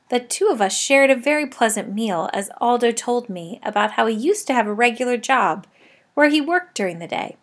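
ordinary delivery

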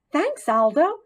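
small squeaky gratitude; grateful squeak. A narrator's reading. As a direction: thank him for the treat. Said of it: "I squeaked".

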